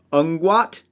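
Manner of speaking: "Ungwat", scrambled nonsense word with a rising end; jumbled letters. Shown as a demonstration. Caster's subtle verbal curse, "Ungwat" as jumbled syllables. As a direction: draw out "Ungwat" slowly, lifting at the end. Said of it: "like the letters have been jumbled".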